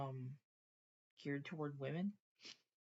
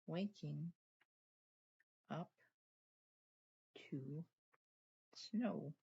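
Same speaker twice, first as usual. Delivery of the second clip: slow dictation while writing; much slower than usual. Real, not acted. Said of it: "I'll write that down right now".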